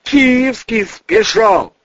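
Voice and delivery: high, slow, forced-out announcement; alarmed cry. Acted. Bodyguard finally names who escaped, crying out in alarm. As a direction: cry it out in panic.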